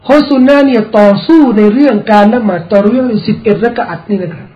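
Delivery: ordinary talk, nothing marked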